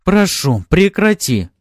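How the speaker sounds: high, loud plea; changed tone, pleading. A narrator's reading. Noblewoman now asks Maxim to stop, urgent plea. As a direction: beg him to stop, urgently. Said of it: "её тон изменился".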